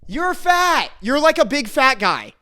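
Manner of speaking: toned-down yell falling back toward normal; mock outburst. Imagined insult to the classmate, mock yelling.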